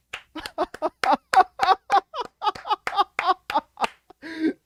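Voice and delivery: high, overjoyed laughter; giddy laugh. Real, not acted. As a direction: laugh out with sheer delight. Celebrating the pick.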